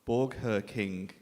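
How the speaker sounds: normal talking voice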